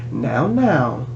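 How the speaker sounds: softly voiced warning; understated villain's warning. A narrator's reading. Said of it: "Doctor Thorne warned".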